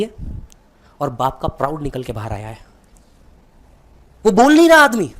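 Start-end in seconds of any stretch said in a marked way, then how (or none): 4.3-5.1 impassioned cry. Real, not acted. Emotional peak of speech.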